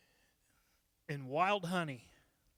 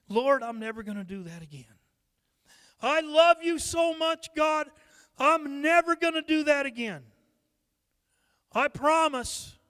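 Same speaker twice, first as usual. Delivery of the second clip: higher, louder, overeager promise to God; mock believer swearing to God he'll change; pleading prayer; 6 semitones higher, 7 dB louder than usual. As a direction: gush a promise you won't keep.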